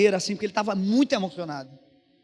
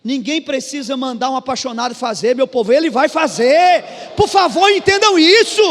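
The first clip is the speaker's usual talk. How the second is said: high, loud preacher's shout; impassioned pulpit cry, sustained at top volume; full-force preaching; 11 semitones higher, 11 dB louder than usual. Real, not acted. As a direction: drive it home to the whole congregation.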